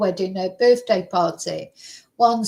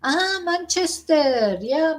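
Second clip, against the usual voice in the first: high, drawn-out mock recognition; slow, high "ah" of imitated recognition; imitating Spanish people; 7 semitones higher, slower than usual. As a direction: play a stranger pleasantly recognising the name, pitched up. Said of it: "Spanish people would say".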